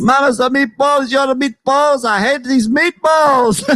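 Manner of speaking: high cartoon Italian rant; hammed-up childish outburst; mock Italian son. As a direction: whine in a bad Italian accent. Acted Italian son ranting at parents.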